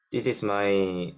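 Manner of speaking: ordinary talk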